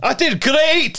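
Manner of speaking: high, gleeful boast; hammy proud voice. Retold or imitated. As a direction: crow like a proud fool. Mocking the killer's pride.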